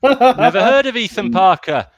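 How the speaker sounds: laughing denial. Pretend. Jokey denial of knowing him.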